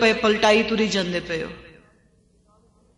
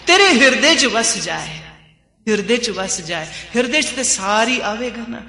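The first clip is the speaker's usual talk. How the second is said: normal talking voice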